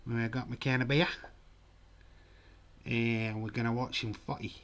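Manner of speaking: hammed-up Aussie, rising line ends; Australian accent. Shown as a demonstration. Streamer showing viewers his Australian accent, hammed-up Australian. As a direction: play a stock Australian bloke. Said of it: "can you do an Australian accent".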